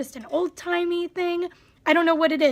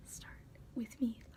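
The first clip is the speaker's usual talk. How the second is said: very soft whispered echo; 5 semitones lower, 18 dB quieter than usual. Real, not acted. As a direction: barely voice it, just air. Whispered repeat, even softer.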